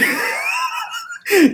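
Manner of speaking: high shouted laugh. Real, not acted. Laughing at a late comment.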